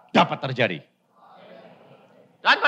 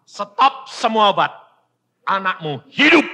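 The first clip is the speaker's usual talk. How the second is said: louder and higher, firm command; commanding voice of God; 6 semitones higher, 6 dB louder than usual. Retold or imitated. God commands the father, loud firm decree. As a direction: repeat the divine order. God's word repeated.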